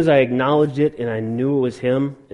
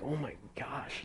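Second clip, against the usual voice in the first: very soft, slow retold gasp of fear; hushed, drawn-out dread, retold; scared, under the breath; 16 dB quieter than usual. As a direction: recall being scared, barely voiced. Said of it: "scared out of my mind".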